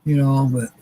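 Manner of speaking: normal talking voice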